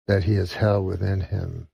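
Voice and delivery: usual speaking voice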